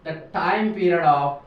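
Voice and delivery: slow, word-by-word dictation with long pauses. Shown as a demonstration. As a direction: dictate each word slowly for students to write. Dictating the law slowly. (धीमे-धीमे रुक-रुक कर लिखवाना)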